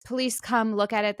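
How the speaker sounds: ordinary delivery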